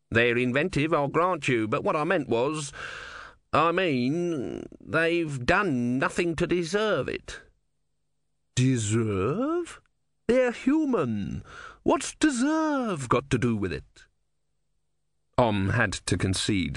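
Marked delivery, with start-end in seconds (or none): 0.1-7.4 a slightly higher voice, hedging; lightly voiced little god making excuses; defensive small god. A narrator's reading. Narrator voices Om pleading. 8.5-14.0 high, drawn-out, dismissive goddess; scornful sea goddess. A narrator's reading. Sea Queen scorns Om's plea.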